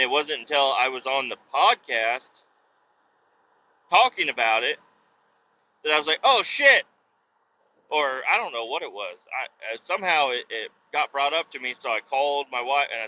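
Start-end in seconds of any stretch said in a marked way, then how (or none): 6.2-6.8 sudden high, loud realization; oh shit moment. Retold or imitated. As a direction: blurt it as it dawns on you. Sudden realization of forgotten package.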